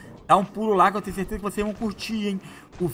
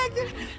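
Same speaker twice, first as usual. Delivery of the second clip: a much higher squealed laugh that breaks into moleque; squealing laugh; 9 dB quieter than usual. Real, not acted. Gamer startled by a mosquito on his nose, high squealing laugh.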